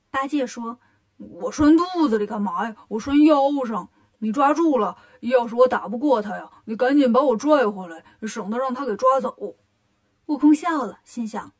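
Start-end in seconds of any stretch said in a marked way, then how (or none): 1.2-9.6 loud, insistent pig character; Bajie, fussy pig-man. Acted. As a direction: explain it loudly and urgently to big brother. Bajie explains his rope plan.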